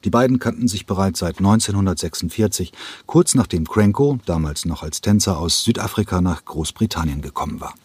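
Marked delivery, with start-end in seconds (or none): none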